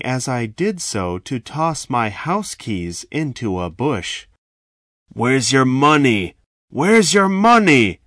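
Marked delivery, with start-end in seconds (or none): 5.1-8.0 loud barked demand, second one higher. A narrator's reading. Robber demanding cash at gunpoint, loud bark. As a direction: bark it, then push it higher. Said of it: "he barked".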